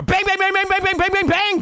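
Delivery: rapid high-pitched bang bang; gunshot sounds. Retold or imitated. Recapping Mice and Men ending, rapid high mock gunshots. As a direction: fire off each bang like a toy gun.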